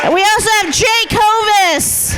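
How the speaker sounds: drawn-out stage announcement of names; rousing host shout, stretching the names; announcer. Real, not acted. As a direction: call the winners' names out to the crowd. Host announces the winners to crowd.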